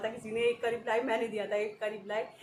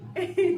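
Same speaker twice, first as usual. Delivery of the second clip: burst of laughter; 7 semitones higher, 7 dB louder than usual. Real, not acted. Bursting out laughing retelling joke.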